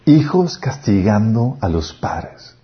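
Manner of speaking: ordinary delivery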